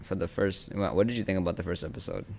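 usual speaking voice

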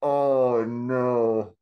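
loud, drawn-out groan of dismay; real, amused horror; dismayed reaction. Real, not acted. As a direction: react aghast to the punchline. Listener reacts to the punchline.

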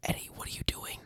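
urgent mock whisper; hissed, whispered telling-off; panicked hushing. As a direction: whisper a scolding at your friend. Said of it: "And I'll be like".

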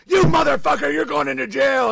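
hammed-up bad cop roaring at a suspect; bad cop yelling. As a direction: yell it like a furious cop. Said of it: "one cop comes in, he's like".

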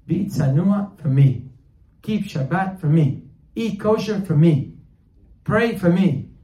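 loud, emphatic, drawn-out appeals; impassioned urging. Acted. God urging his people to keep commandments, impassioned. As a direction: punch out each request slowly and loudly.

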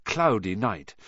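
ordinary delivery, nothing marked

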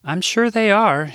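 high, sweet Midwestern lilt; older Midwestern lady, earnest; Midwest accent. Acted. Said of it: "in her Midwest accent".

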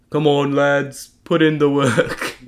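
slow mock English accent, rallying the lads. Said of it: "I'll stop the, the English accent".

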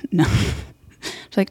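ordinary talk, nothing marked

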